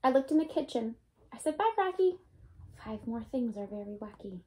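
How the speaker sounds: lively storybook read-aloud, bouncy and bright; reading to children. A narrator's reading. Teacher reading a Dr. Seuss book to her class, lively read-aloud. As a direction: read it like story time for little ones.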